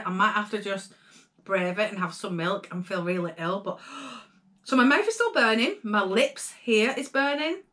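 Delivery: ordinary delivery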